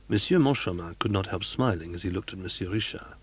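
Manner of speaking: ordinary delivery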